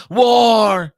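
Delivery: high, loud, drawn-out "war"; mock-dramatic shout. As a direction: blare it like a doom warning. Mock-dramatic meanings of apocalypse.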